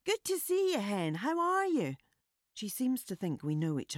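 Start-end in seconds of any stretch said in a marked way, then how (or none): 0.0-2.0 high, bright fake-familiar greeting; over-friendly Scottish woman. A narrator's reading. Woman pretending to know narrator, high Scottish greeting. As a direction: greet her like an old friend, much higher.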